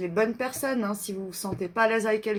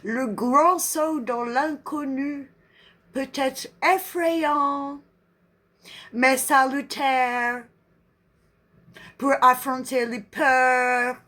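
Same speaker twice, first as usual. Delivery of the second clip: slow French with a put-on English accent; playful, drawn-out English accent; 2 semitones higher, 4 dB louder, slower than usual. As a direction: read it like an English speaker, syllables drawn out. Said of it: "J'aime bien ce petit accent anglais".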